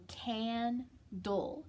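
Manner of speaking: candle drawn out very slowly; slow stretched. Shown as a demonstration. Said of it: "it's got a short A".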